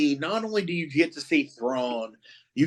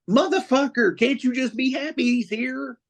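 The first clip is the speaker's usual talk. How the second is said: much higher, indignant outburst; exasperated retort; 11 semitones higher than usual. Acted. Fan hitting back at Star Wars purists, exasperated. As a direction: plead in high-pitched exasperation with the naysayers.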